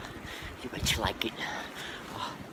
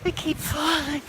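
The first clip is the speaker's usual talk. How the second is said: high whiny wailing; 6 dB louder, slower than usual. Acted. Falling on ice, in pain.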